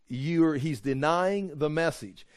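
ordinary delivery

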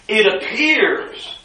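APPEARS lifted high and held; emphatic stress. Real, not acted. Preacher stressing that it only seems so, emphasis on "appears". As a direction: punch "appears", then drop away. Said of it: "I put some emphasis here".

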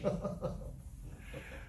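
breathy chuckle; laughing. Real, not acted. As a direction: laugh at your own joke. Laughs at lame joke.

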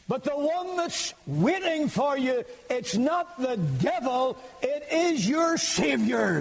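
high-pitched, drawn-out preaching cry; slow, ringing pulpit proclamation; impassioned preaching. Acted. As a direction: preach it urgently, pitch soaring far above normal. Preaching at full force.

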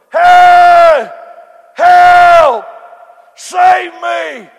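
desperate high screams for help; damned soul crying out. Acted. Preacher acts a soul in hell, screaming for help. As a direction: scream like someone trapped in hell.

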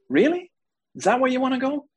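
very high-pitched disbelief; incredulous. Real, not acted. Preacher questions the televangelist's chicken altar call, high incredulous squeal. Mocking the chicken testimony's logic.

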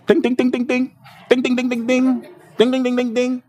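high sing-song bell dings. Pretend. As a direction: chime it out like a bell. Mocking alarm bell sound.

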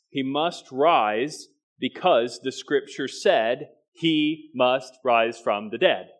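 slow, weighty "he must rise"; emphatic repetition. Shown as a demonstration. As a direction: drive home "must rise" slowly. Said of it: "I'm gonna say it again".

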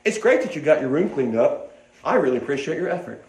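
quietly sincere appreciation of the effort; encouragement. Shown as a demonstration. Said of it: "Encouragement says".

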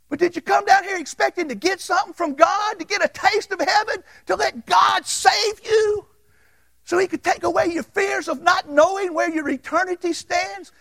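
high, loud, shouted preaching; preaching at full force. Acted. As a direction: rise up and preach it to the back row.

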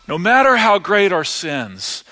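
ordinary talk

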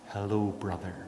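soft, quiet, tender hello; gentle greeting. Retold or imitated. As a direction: hold out forgiveness softly to the guard. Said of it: "reached out her hand and said".

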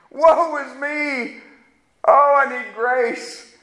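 mild mock lament of a contrite believer; repentant sinner. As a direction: drag it out like a man seeing his sin.

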